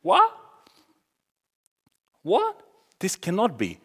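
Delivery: high-pitched, incredulous "what?"; shocked disbelief. Acted. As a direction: react as if it simply cannot be. Acting out shocked onlookers.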